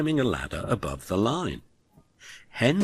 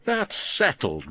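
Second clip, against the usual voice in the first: rumbling Gordon; 13 semitones higher, 4 dB louder than usual. A narrator's reading. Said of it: "rumbled Gordon".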